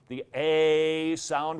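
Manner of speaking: "The A sound" with the A drawn out long and loud. Shown as a demonstration. Teacher stretching out "A" for students, slow and loud. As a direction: stretch out "A" for the class. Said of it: "those are long".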